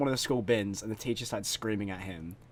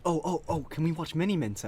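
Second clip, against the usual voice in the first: imitating an eager, higher-pitched classmate; mock-eager pupil, pitched up; eager request; 5 semitones higher than usual. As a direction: retell a kid's excited plea. Classmate eagerly begging to watch video.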